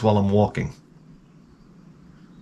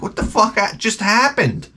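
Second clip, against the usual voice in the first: high, stunned what-the; shocked disbelief; 8 semitones higher than usual. Retold or imitated. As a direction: gasp it out in disbelief. Shocked at exploding glass.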